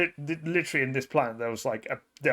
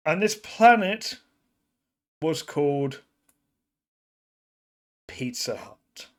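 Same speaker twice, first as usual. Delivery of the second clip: dramatic storytelling; 3 semitones higher, 4 dB louder than usual. Acted. Storyteller recounting a perilous voyage to a strange planet, dramatic storytelling. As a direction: draw out the reveal phrase by phrase.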